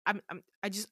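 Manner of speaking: halting, repeated false starts; stumbling over words. Acted. Damien answering her angry challenge, stumbling over words. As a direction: stammer and never finish the sentence. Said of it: "stumbling over himself".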